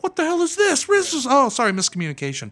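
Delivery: high, loud bewildered demand; aghast outburst. Acted. Promoter seeing the wrong winner, loud aghast outburst. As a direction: demand an explanation in disbelief. Acted promoter's shocked reaction.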